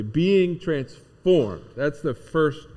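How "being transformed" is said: "Being transformed" drawn out slow and louder; slow emphatic phrase. Shown as a demonstration. Pastor to the congregation, unpacking the definition, slow and weighty. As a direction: stretch each word of "Being transformed" slowly. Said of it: "Let's walk through it just quickly".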